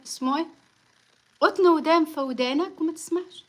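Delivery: ordinary talk, nothing marked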